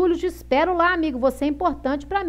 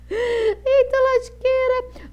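surprised; 10 semitones higher, 4 dB louder, much slower than usual. Real, not acted. Shocked exclamation at chat.

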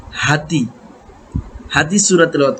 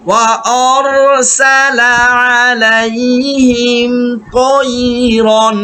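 higher, slow chant; Quran recitation; 4 semitones higher, much slower than usual. Shown as a demonstration. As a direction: recite it melodically. Model recitation. (উঁচু সুরে তেলাওয়াত)

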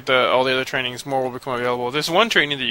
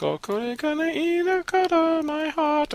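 put-on much higher, slow, sugary girlish singing voice; mock anime girl; much slower than usual. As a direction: ham up a lovestruck idol, dragging it out. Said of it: "She's like".

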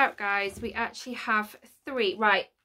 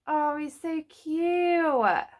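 cooing; 5 semitones higher, 5 dB louder, much slower than usual. Real, not acted. Cooing over a figure.

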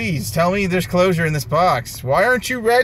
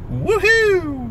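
sarcastic woohoo; 10 semitones higher than usual. Real, not acted. Sarcastic cheer at bad pull.

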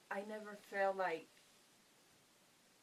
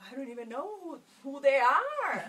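incredulous protest; 5 semitones higher, 12 dB louder than usual. Retold or imitated. Retelling her doubts about strangers, incredulous protest. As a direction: protest in disbelief, stretching the end.

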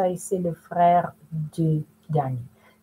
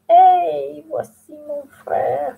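startled; 7 semitones higher, much slower than usual. Acted. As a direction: caught in the act, startled. Caught by brother in retelling.

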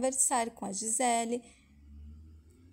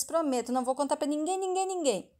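higher, quick mock child promise; lightly acted, insistent little promise; eager child's promise; 4 semitones higher, 3 dB louder than usual. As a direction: rattle it off like a child swearing secrecy. Child eagerly promising in retold dialogue.

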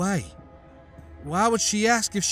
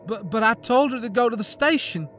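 much higher stammering wail; 9 semitones higher, 3 dB louder than usual. A narrator's reading. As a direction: cry out in disbelief. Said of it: "Annette wailed".

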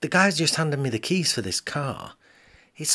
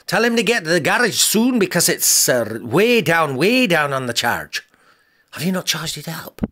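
loud, high gruff Scottish voice; gruff Scottish lord; 7 semitones higher, 6 dB louder than usual. Acted. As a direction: bellow it like a Highland laird. Scottish garage man on phone.

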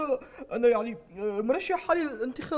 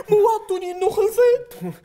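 louder, crying complaint; whining cry; 5 semitones higher, 6 dB louder than usual. Acted. Spoiled leader crying at his aides, whining cry. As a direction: wail like a spoiled child.

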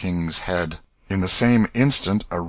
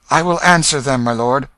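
confident answer; 5 semitones higher, 7 dB louder than usual. A narrator's reading.

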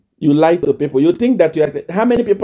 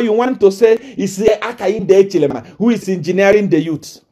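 ordinary delivery

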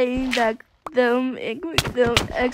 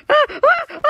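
panicked; 13 semitones higher, 5 dB louder than usual. Real, not acted. Panicked high stammer.

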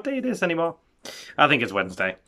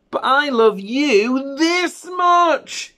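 high, very slow swell on "this much"; stress on THIS MUCH; 10 semitones higher, 6 dB louder, much slower than usual. Acted. Big hare outdoing little hare, "this much" drawn out high. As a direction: stretch "this much" out long and high.

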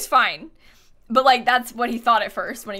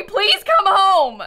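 slow, high, louder hammed-up plea; mock begging; 8 semitones higher, 6 dB louder, much slower than usual. Streamer begging a villager to return, slow mock plea. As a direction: drag out the plea like a heartbroken soap star. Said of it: "Have you tried apologizing to Pietro?".

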